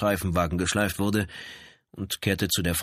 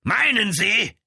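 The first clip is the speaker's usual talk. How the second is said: high, loud roar in his face; lieutenant roars right in the brother's face; 6 dB louder, slower than usual. A narrator's reading. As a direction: bellow it right at him. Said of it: "Dann brüllte er ihm ins Gesicht".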